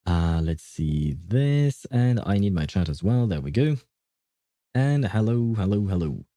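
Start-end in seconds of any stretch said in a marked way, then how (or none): none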